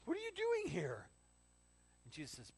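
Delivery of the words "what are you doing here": very high, loud mock alarm; startled shriek. Disciples seeing Jesus appear, shrieking surprise. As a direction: squeal it in shock. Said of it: "it's like the screaming goat moment".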